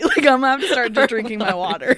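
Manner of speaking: tipsy and giggling. Real, not acted. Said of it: "It's just me and alcohol".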